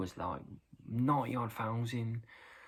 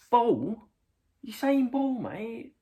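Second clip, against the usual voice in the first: loud, high puzzled query; high-pitched acted confusion; baffled question; 11 semitones higher, 6 dB louder, much slower than usual. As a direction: ask it in mock bewilderment. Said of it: "what are they saying".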